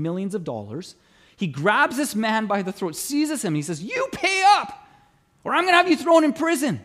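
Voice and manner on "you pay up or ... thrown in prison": higher, louder threat, pitch jumping up; angry demand. Retold or imitated. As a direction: snarl it like a creditor grabbing a throat. Servant grabs debtor by throat.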